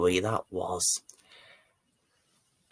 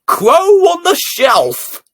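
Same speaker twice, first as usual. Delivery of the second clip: very high, slow, loud crow-like croak; old crow voice; 10 dB louder, slower than usual. Acted. Great-grandpa names himself Crow on the Shelf, high and croaky. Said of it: "He calls himself".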